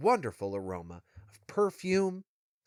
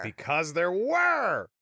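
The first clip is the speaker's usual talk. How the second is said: drawn-out, higher stress on "were"; emphatic echo; 4 semitones higher, slower than usual. Real, not acted. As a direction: chime in slowly, stretching out "were". Emphatically echoing co-host's point.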